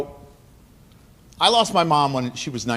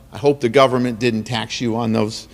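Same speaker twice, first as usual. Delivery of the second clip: ordinary talk, nothing marked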